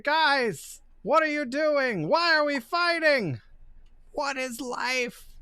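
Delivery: high, pleading gargoyle voice; mock-anguished gargoyle questioning everything; conflicted gargoyle. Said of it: "He's like".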